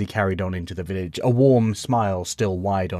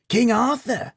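put-on high surprised old man; old codger echoing the name; slower than usual. A narrator's reading. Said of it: "he replied".

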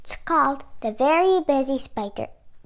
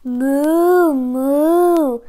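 loud, long drawn-out moo; cow moo; 10 dB louder than usual. Acted. Voicing the cow for young listeners, loud mooing. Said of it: "said the cow".

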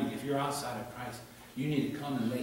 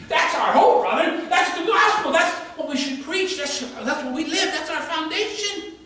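much higher and louder, triumphant cry; jubilant preaching; 9 dB louder than usual. Acted. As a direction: lift it up like a shout of victory. Preacher exulting in the gospel.

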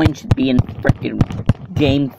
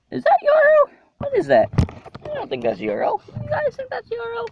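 squeaky high voice. Pretend. High silly squeaky questions.

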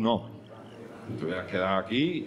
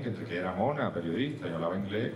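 ordinary talk

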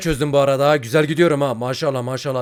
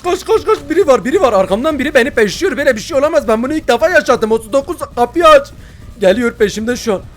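high, loud panicked shouting; frantic panic, running for your life; being chased; 10 semitones higher, 9 dB louder than usual. Real, not acted. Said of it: "Ben hayatımda böyle bir gerilmedim".

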